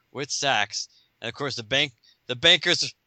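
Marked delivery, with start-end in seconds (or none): 0.0-0.9 "With Sax." said high through real laughter; cracking up on the repeat; laughing. Real, not acted. Chuckling as he repeats the sidekick's name.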